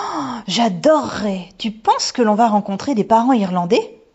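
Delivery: put-on high, loud little-girl excitement; thrilled. A narrator's reading. Daughter to mother about visiting the house, thrilled. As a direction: gush with delight, then ask eagerly.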